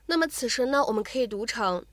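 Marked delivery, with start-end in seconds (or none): none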